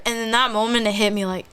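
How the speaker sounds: normal talking voice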